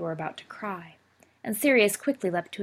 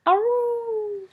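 short high playful howl; mock wolf howl; 5 dB louder than usual. A narrator's reading. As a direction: howl like a werewolf for fun. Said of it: "Sirius howled softly under his breath".

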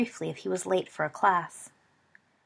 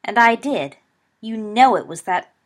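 louder, defiant retort; scowling; 3 semitones higher, 10 dB louder than usual. A narrator's reading. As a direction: snap it back defiantly. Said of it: "Sirius scowled".

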